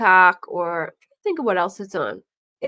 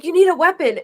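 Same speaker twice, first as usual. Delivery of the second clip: mock urgent; 9 semitones higher, 6 dB louder, faster than usual. Voicing pranksters handing weapon.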